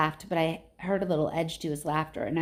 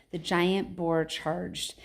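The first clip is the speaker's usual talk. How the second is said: ordinary delivery, nothing marked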